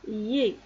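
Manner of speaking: "yé" as one gliding syllable; prononciation démontrée. Shown as a demonstration. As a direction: say "yé" clearly for learners. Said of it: "ça se prononce". (« yé » prononcé en une syllabe)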